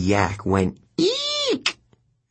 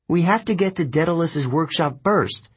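put-on high, firm young girl voice; insistent Annabeth; 7 semitones higher than usual. A narrator's reading. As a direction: press the point, firm and eager. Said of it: "Annabeth insisted".

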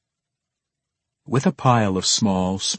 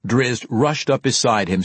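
ordinary delivery

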